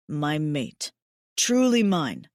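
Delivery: lightly voiced male lover, low then fuller; a hint of a devoted big mate; tender alien mate.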